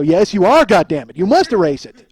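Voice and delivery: loud, emphatic mock outrage; insistent demand. Man orders friend to erase the tape, insistent. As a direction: order him, punching the words. Acted argument over erasing tape.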